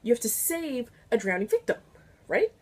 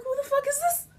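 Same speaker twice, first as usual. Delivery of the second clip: shocked disbelief; 14 semitones higher than usual. Retold or imitated. Shocked at obese victim.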